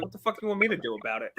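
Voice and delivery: fast, exasperated comeback; retold snippy reply. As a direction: rattle it off, annoyed. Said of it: "And I was like".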